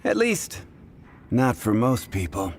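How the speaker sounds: normal talking voice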